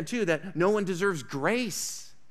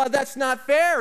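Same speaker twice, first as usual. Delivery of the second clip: whiny protest, pushed louder; mock complaint; 7 semitones higher, 6 dB louder than usual. People in the mall protesting to the giver, whiny and loud. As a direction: complain like a sulking child. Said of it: "They might go".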